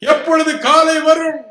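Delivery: high, loud anguished cry of when will morning come; retold night cry. Sick man crying out through the night, loud and high. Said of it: "கூச்சலிடுவேன்".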